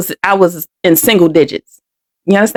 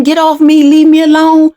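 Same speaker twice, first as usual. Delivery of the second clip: higher, louder mock yell of a scared girl; retold yelling; 6 semitones higher, 4 dB louder than usual. Girl yelling at the man holding her down, in a mild retold shout. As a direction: yell it as the frightened child, held back. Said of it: "So I'm yelling".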